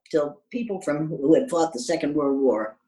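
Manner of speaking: normal talking voice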